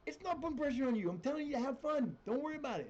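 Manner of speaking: high-pitched, indignant retort; exasperated protest. Retold or imitated. Retold exasperated reply to team.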